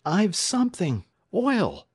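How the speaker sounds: high, loud eureka shout; high-pitched, triumphant exclamation; excited cry. A narrator's reading. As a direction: burst out with the sudden idea. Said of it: "a cry from Alex".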